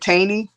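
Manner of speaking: "Tani" as TAY-nee, loud and questioning; name said TAY-nee. Shown as a demonstration. Vlogger guessing at a viewer's name, asks "Tani" as TAY-nee. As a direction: say "Tani" with a long a, like "rain". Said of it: "am I saying it wrong?".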